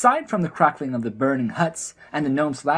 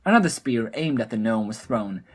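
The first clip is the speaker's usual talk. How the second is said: usual speaking voice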